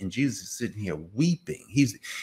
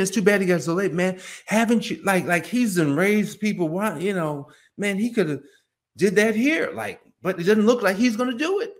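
high, raised-voice grumbling; gossiping complaint; 8 semitones higher, 4 dB louder than usual. Acted. Friends at the grave doubting he will act, high indignant grumbling. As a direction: complain insistently, pitched up. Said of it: "That's what they're whispering".

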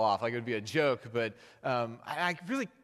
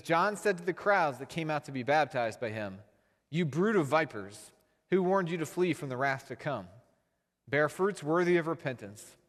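measured, slightly raised reading of scripture; 2 semitones higher than usual. Acted. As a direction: read the passage to the congregation. Reads scripture aloud.

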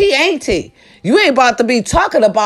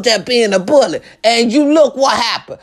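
usual speaking voice

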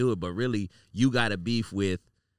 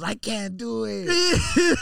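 whiny despair; 10 semitones higher, 5 dB louder, much slower than usual. Retold or imitated. Retold distressed producer quote.

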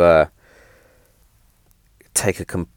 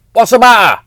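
higher, louder "what's the matter"; exasperated call; 12 semitones higher, 7 dB louder than usual. Retold or imitated. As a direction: call up to her, fed up. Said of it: "I'm like".

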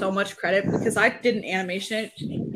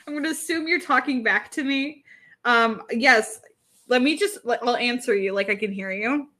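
ordinary talk, nothing marked

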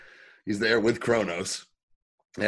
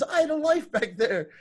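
mock whiny Jax; 13 semitones higher than usual. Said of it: "he goes".

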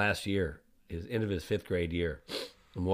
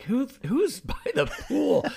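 much higher, slow incredulous question; dad baffled at charges on his hotel bill; surprised question; 13 semitones higher, 5 dB louder, slower than usual. Retold or imitated. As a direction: ask it in drawn-out disbelief. Dad finding pool charges.

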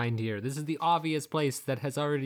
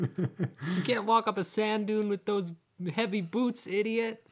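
much higher mocking voice through laughter; jeering Bedin laughing; 9 semitones higher than usual. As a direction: laugh down at the climber. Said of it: "just like".

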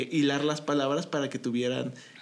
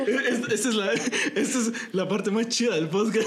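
laughing, excited higher voice; real amused delight; 5 semitones higher than usual. Real, not acted. Host delights in the podcast's best part, laughing. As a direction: talk through the laughter. Cracking up at the Nahuatl demo.

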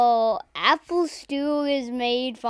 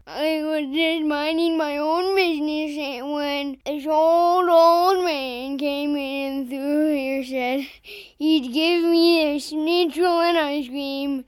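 slow drawn-out put-on voice; put-on old man voice. Caught stand-in speaks to the detectives, slow put-on voice. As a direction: drag every word out slowly. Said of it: "if I talked like this".

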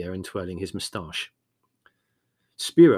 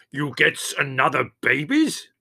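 louder, higher, scowling outrage; ferocious roar; 5 semitones higher, 6 dB louder than usual. A narrator's reading. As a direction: demand it fiercely in a put-on foreign voice. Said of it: "scowling ferociously".

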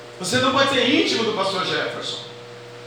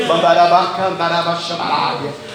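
fast glossolalia burst; speaking in tongues; 5 semitones lower, 3 dB louder than usual. Acted. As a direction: babble in tongues fervently. Speaking in tongues mid-sermon.

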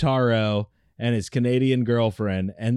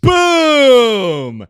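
high, drawn-out holler; playful full-volume crowing; triumphant shout; 11 semitones higher, 11 dB louder than usual. Pretend. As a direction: bellow it in mock victory. Crowing after a rivalry jab.

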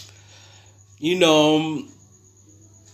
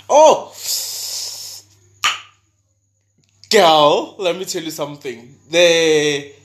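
excited outburst; 6 semitones higher, 8 dB louder than usual. Real, not acted. Gushing about ex-lover.

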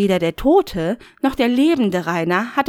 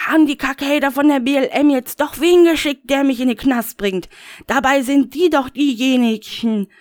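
high, louder paranoid rant; Rainer, high ranting; 6 semitones higher, 4 dB louder than usual. Acted. Rainer rants to the Voice, high and indignant.

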